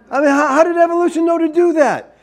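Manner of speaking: high, laughing mockery of evolution; high sarcastic laugh through the question; mocking laugh. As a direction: scoff at the idea with a laugh.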